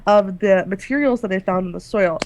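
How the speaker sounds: normal talking voice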